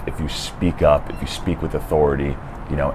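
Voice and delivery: ordinary talk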